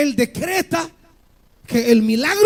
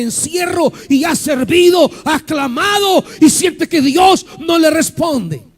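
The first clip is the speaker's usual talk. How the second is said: full force preaching; 3 semitones higher than usual. Acted. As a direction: preach it hard to the congregation.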